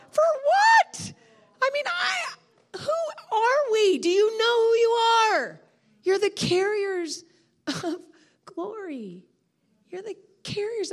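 high, slow, loud and overwrought; overwhelmed wailing shout; tearful cry. Real, not acted. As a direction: cry it out through tears. Preacher overcome, crying out in tears.

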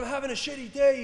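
high, loud mock rant; worked-up, high-pitched complaint; raging. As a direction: imitate a fuming driver yelling back. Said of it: "he says, and he's raging".

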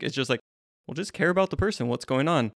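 usual speaking voice

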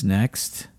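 a flat, clipped "Next" from a bored curator; curt, no emotion. Retold or imitated. Curator dismissing a drawing, curt and unimpressed. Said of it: "no emotion".

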